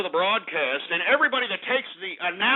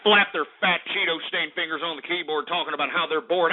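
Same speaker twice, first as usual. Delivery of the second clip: normal talking voice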